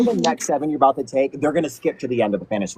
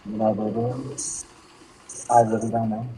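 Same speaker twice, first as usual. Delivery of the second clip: gentle mom voice; 4 semitones lower, 7 dB quieter than usual. Retold or imitated. Mom answering child gently.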